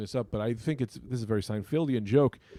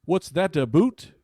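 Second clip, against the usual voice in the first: put-on higher Canadian-accent punchline; mock Canadian; 4 semitones higher, 5 dB louder, slower than usual. Comic quoting Canadians seeing Italy's map, mock Canadian accent. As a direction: deliver the joke in mock Canadian. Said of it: "what the Canadians say".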